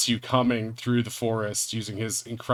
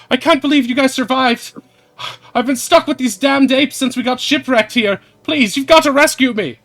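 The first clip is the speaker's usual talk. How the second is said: high, loud desperate calling; Polly calling out; 13 semitones higher, 10 dB louder than usual. Acted. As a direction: call down from a tower pleading. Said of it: "Polly's calling out".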